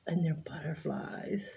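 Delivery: whispered aside. Real, not acted. Excited gift unboxing aside.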